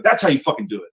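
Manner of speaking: heated retold one-upping; retold showing-up. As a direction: act out rubbing his nose in it. Acted quarrel, showing him up.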